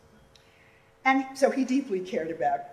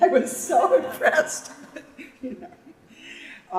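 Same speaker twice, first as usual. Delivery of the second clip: louder and slower, dissolving into laughter; laughing; 7 semitones higher, 8 dB louder, much slower than usual. Real, not acted. Telling the audience about his sister, laughing. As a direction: laugh at how alike they looked. Amused at siblings' matching hair.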